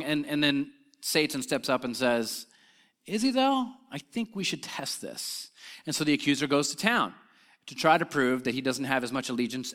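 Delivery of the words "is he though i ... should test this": sly doubting challenge. Acted. Satan steps up to challenge God about Job, slyly doubting. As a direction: plant doubt, dropping to a quiet suggestion.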